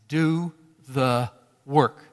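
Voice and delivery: drawn out slowly, each word weighed; slow emphatic command. Acted. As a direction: lay every word down like a stone. Preacher's repeated exhortation.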